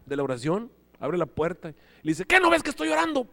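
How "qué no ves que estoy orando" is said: high, loud irritated snap; annoyed retort. Retold or imitated. Praying man snaps high and loud at his roommate. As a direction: snap back, offended at being interrupted.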